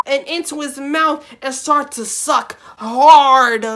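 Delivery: loud, high and slow; hammed-up emphasis. Reading the raunchy bit aloud, loud and drawn out. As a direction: stretch the line out loud for effect.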